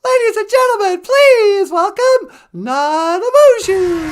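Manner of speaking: very high, loud, slow announcer call; squeaky announcer. Acted. Announcing Nanabushi to the audience, high and grand. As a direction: introduce her like a tiny showman.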